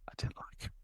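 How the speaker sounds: breathy whispered confession, almost silent. Real, not acted. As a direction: whisper it like a guilty secret. Confessing he disliked the boss.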